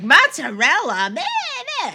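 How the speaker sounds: loud, high, drawn-out mock bleat; mocking mimicry. Retelling how they corrected her mozzarella, mocking mimicry. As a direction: hammer the word, then squeal mockingly high. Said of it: "That's exactly the voice you're making".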